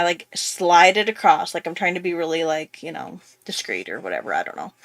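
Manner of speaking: ordinary delivery, nothing marked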